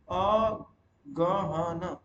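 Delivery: "Aghan" very slowly, "a... ga... ha... na"; drawn-out dictation of "Aghan"; syllable-by-syllable model. Shown as a demonstration. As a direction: break "Aghan" into long separate syllables. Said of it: "अगहन नहीं होता".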